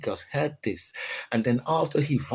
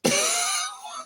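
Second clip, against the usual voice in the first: high laugh. Real, not acted. Laughing at story.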